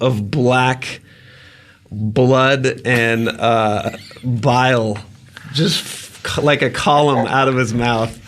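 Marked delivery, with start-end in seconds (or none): none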